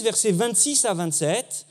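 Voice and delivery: ordinary delivery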